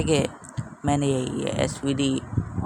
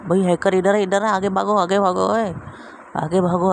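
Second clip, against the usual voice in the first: higher, louder calls to run; urgent shouting; 4 semitones higher, 3 dB louder than usual. Real, not acted. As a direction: yell at the team to move. Urging teammates to run. (ऊँची आवाज़ में जल्दी मचाना)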